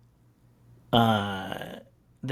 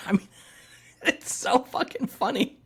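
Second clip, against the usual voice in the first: high, laughing through the words; cracking up; 10 semitones higher than usual. Real, not acted. Host to co-host about the comic, cracking up. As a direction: barely get it out through real laughter.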